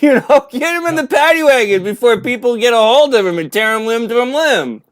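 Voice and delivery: very high, loud satirical yelling; mock frantic shouting. Mock officer rushing a suspect to safety, shouting frantically. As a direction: holler in hammed-up panic to protect someone. Acts out police protecting a gunman.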